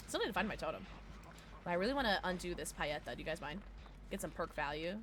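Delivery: ordinary talk, nothing marked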